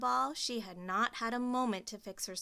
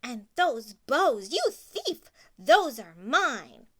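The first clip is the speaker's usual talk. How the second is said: furious accusing; 3 semitones higher, 11 dB louder, slower than usual. Acted. Stepsister to Cinderella, loud and furious. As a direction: shriek accusations.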